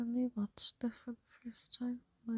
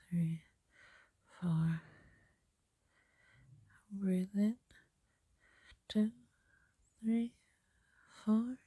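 guided breathing; slower than usual. Acted.

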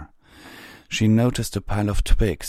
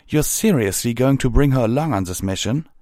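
higher, louder challenge; incredulous objection; 6 semitones higher, 5 dB louder than usual. Acted. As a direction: question the captain's decision in disbelief. Pyre Valor objects incredulously.

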